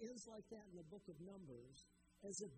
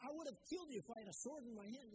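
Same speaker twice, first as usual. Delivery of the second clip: higher, fast, heated threat; quick, higher furious retort; angry threat; 6 semitones higher, faster than usual. Acted. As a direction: rattle off the threat in a fury. Balaam threatening the donkey.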